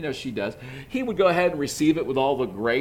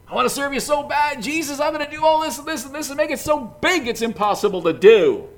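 eager overdoer; 11 semitones higher, 6 dB louder, faster than usual. Acted. Voicing our eager overdoing.

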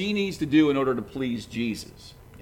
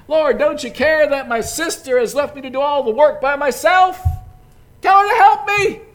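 higher, louder exasperated outburst; blurting out; 11 semitones higher, 10 dB louder than usual. Acted. Martha blurting her complaint at Jesus, higher and louder. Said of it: "blurts out".

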